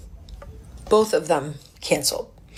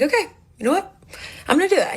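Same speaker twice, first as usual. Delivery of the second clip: higher, laughing self-pep-up; excited agreeing; 9 semitones higher, much faster than usual. Retold or imitated. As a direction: talk yourself into the date with a laugh. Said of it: "I'm like".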